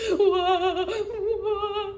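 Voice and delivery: loud, high cartoon crying; mock wailing. Little Beaver cries alone by the pond, loud mock wailing. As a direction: wail out a big babyish cry. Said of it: "said Little Beaver".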